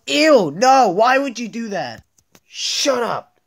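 high, loud shrieks of disgust; disgusted yell. Acted. Student reacting to teacher spitting, disgusted shouting. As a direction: scream it like you were spat on.

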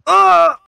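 high, loud mock cry of delight; hammed-up yelp of pleasure; a shrieked ah of delight; mock ecstatic cry.

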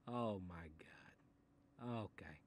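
breathy whisper of dread; slow, whispered oh my God. Acted. Dreading the book's rule.

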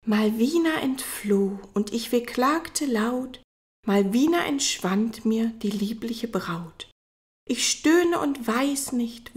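slow, hushed, half-whispered lament; mournful recital. Acted. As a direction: recite like a doleful poet. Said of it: "mit so volltönender Stimme vorzutragen".

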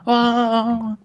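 high, slow hammed-up whoa; overplayed wonder, drawn out; mock amazement. Mocking friend walking on lava.

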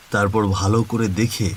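usual speaking voice

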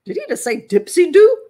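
can't believe what you just heard; did he really just say that; baffled surprise. Pretend. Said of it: "You're like".

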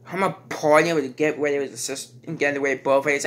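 usual speaking voice

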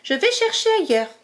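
high, hurried mouse voice; a small mouse rushing to hide her prize; tiny excited mouse; eager little mouse. Acted. Zazie speaking.

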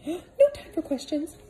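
high put-on voice of a hurried woman; flustered housekeeper. Missus Molasses rushing Peter home, high fussy voice. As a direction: hustle him along in a high fussy voice.

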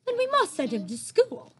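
high, firm royal voice; regal Queen. Acted. The Queen deciding about the giant, regal voice. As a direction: declare it like a queen.

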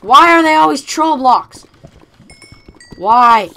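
loud cry of frustration; protesting loudly at being tricked; exasperated shout. Real, not acted. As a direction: yell it in outraged disbelief. Fooled by fake diamonds again.

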